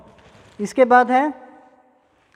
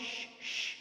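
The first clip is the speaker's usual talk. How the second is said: a soft voiceless shhh; pure sh sound, no voice; whispered sh hiss; 18 dB quieter than usual. Shown as a demonstration. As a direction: breathe out श as a long hush. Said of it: "स और य के बीच की sound श".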